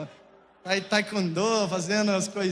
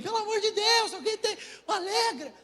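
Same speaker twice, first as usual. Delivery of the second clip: much higher, frantic begging; desperate plea; 11 semitones higher than usual. Retold or imitated. Parents begging neighbours for allergy medicine, frantic and high. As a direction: beg the neighbours in a panic. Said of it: "A gente já saiu pelo condomínio".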